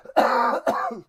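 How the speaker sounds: real throat-clearing cough. Real, not acted. Clears throat after shouting.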